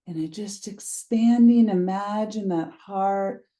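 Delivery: calm guided meditation read, unhurried pauses. Acted. Teacher guiding listeners through a grounding practice, meditation voice. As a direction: lead them gently into the body.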